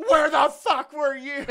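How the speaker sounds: very high, slow, full-volume yell; hammed-up furious shriek; outraged scream. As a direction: scream the punchline as the betrayed kid. Boy screams back at his mother.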